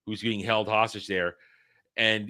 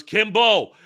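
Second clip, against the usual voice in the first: mock call; 8 semitones higher, 8 dB louder than usual. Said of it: "it goes".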